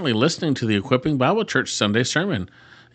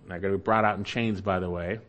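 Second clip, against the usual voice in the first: ordinary talk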